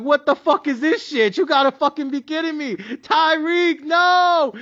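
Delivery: high, outraged yell at the TV; mock screaming at the TV. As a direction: shout at the screen in disbelief. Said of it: "I was over there screaming at the T V".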